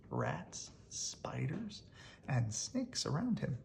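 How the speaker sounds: usual speaking voice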